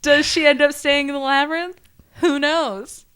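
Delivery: teasing. Real, not acted. Teasing cliffhanger wrap-up.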